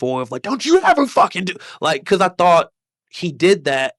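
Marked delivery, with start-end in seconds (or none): 0.4-1.6 high-pitched scolding outburst; retold screaming. Comic berating the man wrongly blamed, high angry scolding. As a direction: re-enact chewing someone out, voice pitched up. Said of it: "screaming at him".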